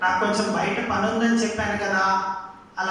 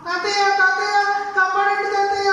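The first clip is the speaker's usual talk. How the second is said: much higher, louder panicked cries; screaming for help; 9 semitones higher, 4 dB louder than usual. Acted. Stung boy screaming to his grandfather for help. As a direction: beg grandpa to save you.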